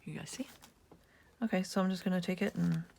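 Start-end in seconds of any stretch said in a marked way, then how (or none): none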